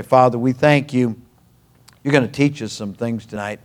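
low, hushed pastoral prayer. Real, not acted. Leads a prayer.

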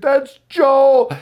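high, loud anguished cry; reliving the moment she saw her son; sudden high cry of horror; retold cry of recognition. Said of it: "She said".

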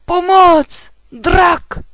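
high, loud cry for help; terrified roar. A narrator's reading. Knight shouting at a dragon in his path, roaring. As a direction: bellow the alarm at full voice. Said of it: "zařval rytíř".

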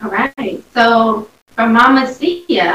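ordinary talk

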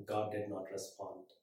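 ordinary talk, nothing marked

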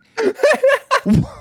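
loud squealing laugh; high burst of laughter. Real, not acted. Loud laugh after story.

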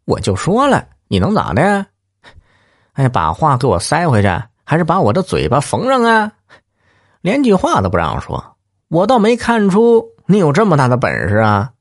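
high, cocky provocation; taunting. Acted. Lout goads the angry husband, high sneering taunt.

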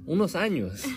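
chuckling through the words; laughing. Real, not acted. Telling her parents' story, laughing through the words. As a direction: let a laugh slip in as you say it.

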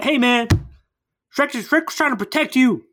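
lightly acted urgency; mock urgent appeal. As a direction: pitch up to convince a friend.

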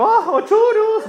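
very high slow cartoon falsetto; high slow cartoon squeak; Mickey. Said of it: "ese es Mickey".

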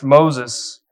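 loud, firm call of a name; God summoning someone by name. Acted. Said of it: "God called out to him".

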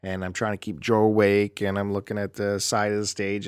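normal talking voice

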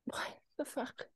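shocked, breathy whispered disbelief; stunned whisper. Real, not acted. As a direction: gasp it out under your breath. Stunned at Fulham's league position.

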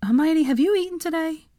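a hint of a worried young man; concerned friend. A narrator's reading. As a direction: check on her like a worried friend. Harry voiced in reading.

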